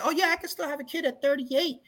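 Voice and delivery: high-pitched mock woman's voice; put-on high voice for a quote; imitated woman. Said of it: "I've had women turn around and say".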